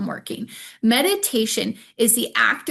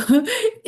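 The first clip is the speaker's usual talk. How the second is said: short high laugh; 12 semitones higher, 5 dB louder than usual. Real, not acted. Laughs at own joke.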